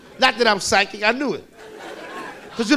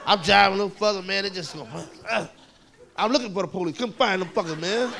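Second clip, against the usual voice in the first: usual speaking voice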